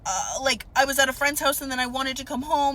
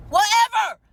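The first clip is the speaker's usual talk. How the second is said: much higher, louder burst of annoyance; exasperated shout; 12 semitones higher, 7 dB louder than usual. Retold or imitated. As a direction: throw it out in a loud fed-up yell. Mimicking her own annoyed response.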